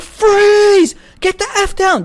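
high, loud shouted police command; cops yelling. Retold or imitated. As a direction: bark it like a cop with a gun drawn. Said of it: "they're like".

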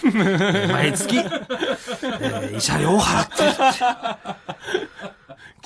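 real laughter breaking through words; laughing. Real, not acted. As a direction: talk while cracking up. Laughs telling alimony total.

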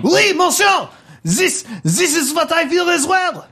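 high, thrilled French bomb; French accent. Acted. Bomb overjoyed to be understood, high excited French accent. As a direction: exclaim agreement in high mock French. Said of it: "It's got eyes and a French accent".

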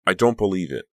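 high-pitched cry. A narrator's reading. Becca reels at the rumor about her, high-pitched cry. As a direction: repeat it harder, lifted and incredulous. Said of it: "cry in a high-pitched voice".

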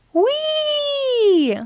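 very high, long held squeal; gleeful "whee". A narrator's reading. Naughty Ninja sliding across the room, gleeful squeal. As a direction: let it out like a child on a slide.

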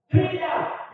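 Frida called out high and loud; high shrill cry. A narrator's reading. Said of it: "gridò Margherita con voce acuta".

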